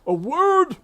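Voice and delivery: very high, loud squeaky disbelief; a guard mouse scoffing in a high shout; shrill-pitched guard mouse, loud and amazed; incredulous hooded mouse. A narrator's reading.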